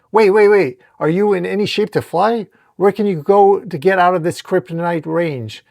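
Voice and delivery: agitated hero firing questions; urgent protest. Acted. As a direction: stop them with a loud burst of worry. Spider-Man stops injured Superman.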